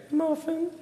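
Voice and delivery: high, squeaky, sheepish Muffin; tiny high mortified voice; squeaky embarrassed answer. Retold or imitated. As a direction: squeak out the cat's name like a confession. Admitting cat's name, squeaky.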